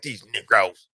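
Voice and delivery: lightly voiced mock disapproval. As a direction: grumble it like a snooty stranger.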